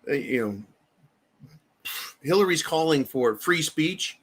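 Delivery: usual speaking voice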